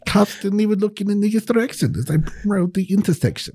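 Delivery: high mock-German rap; German accent. Performing the song for a friend, German accent. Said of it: "in your best German accent".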